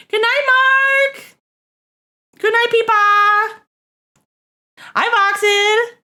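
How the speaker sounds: stretched, high-pitched hellos to chat; sing-song greetings. Real, not acted. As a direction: call each name out long and bright.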